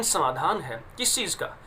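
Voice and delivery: ordinary delivery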